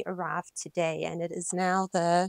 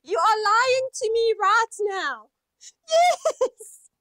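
much higher and louder, overjoyed; thrilled squeal; 12 semitones higher, 8 dB louder than usual. Real, not acted. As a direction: shriek with delight. Thrilled reaction to gift.

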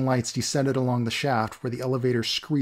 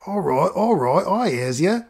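higher, louder cockney doorman; gruff doorman voice; 6 semitones higher, 4 dB louder than usual. Acted. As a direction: bark through the gate like a grumpy doorman. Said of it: "came a voice from the other side".

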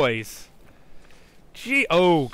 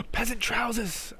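ordinary talk, nothing marked

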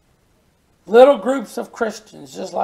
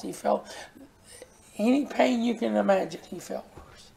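whispered, much softer; hushed; 9 dB quieter than usual. Real, not acted. Preacher on Christ's suffering, whispered hush. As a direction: drop to a breath to make it land.

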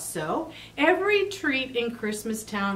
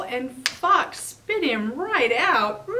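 ordinary delivery

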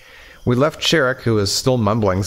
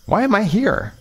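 confused fear; 11 semitones higher than usual. Acted. Confused man, high voice.

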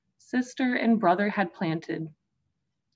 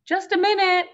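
muffled voice; 11 semitones higher, 5 dB louder, faster than usual. Acted. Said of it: "Holly's muffled voice came from inside".

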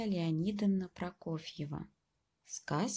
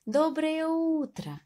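high, slow, sing-song good morning; cheerful mouse greeting; 9 semitones higher, slower than usual. Acted. Mouse greeting the hares, high and drawn out. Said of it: "Всем зайцам сказал".

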